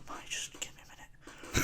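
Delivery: weak breathless whisper; too sick to speak up. Retold or imitated. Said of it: "having to stop".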